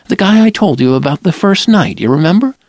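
a hint of a nervous young woman; Lila's voice.

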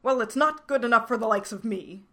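put-on a yam creature insisting to the old man. A narrator's reading. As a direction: push the point, loud and haughty. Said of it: "insisted the yam person".

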